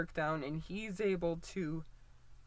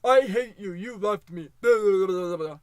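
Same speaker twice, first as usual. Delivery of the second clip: much louder hammed-up tantrum voice; mock furious yell; 12 dB louder than usual. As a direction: yell like a bitter abandoned son, then trail into blah.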